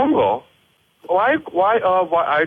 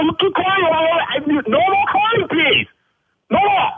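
angry yelling; 8 semitones higher than usual. Acted. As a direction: shout him off the line. Owner yells at caller.

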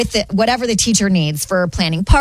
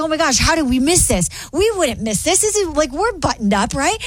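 higher-pitched, flustered disbelief; mildly frantic, pitched up; retold freaking out; 6 semitones higher than usual. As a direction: act out a friend panicking over a slip-up. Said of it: "freaking out".

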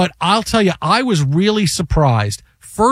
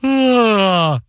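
drawn-out mock yawn, a bit higher; 4 semitones higher than usual. Husband yawning at his wife in labor, lazy yawn. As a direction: act out a big lazy yawn. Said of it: "and I go".

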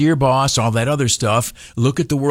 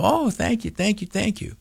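mock gratitude; 9 semitones higher, 6 dB quieter than usual. Grateful reply to boss.

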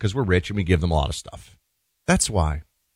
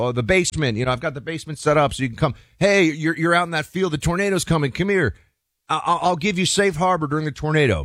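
higher, friendly calling-in voice; eager neighbor offering shelter; 7 semitones higher than usual. Retold or imitated. Host acting homeowner calling neighbor in from tornado, higher and eager. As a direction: play the helpful neighbor waving them inside. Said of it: "You go".

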